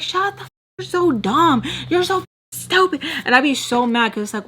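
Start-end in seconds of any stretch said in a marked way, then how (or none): 0.0-3.0 high-pitched, exasperated retort; drawn-up mock rant; pissed-off insult. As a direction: re-enact an angry outburst, pitched up. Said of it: "it pissed me off".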